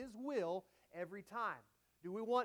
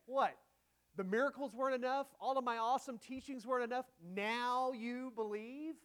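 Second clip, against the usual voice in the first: sarcastic reading; 3 semitones higher, 4 dB louder than usual. Shown as a demonstration. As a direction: scoff like the proof was never enough. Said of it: "read that sarcastically".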